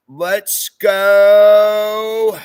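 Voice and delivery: loud, high rallying cry; loud, excited read of a fan's cheer. Retold or imitated. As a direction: belt it like a hype chant. Reading a viewer's cheer aloud.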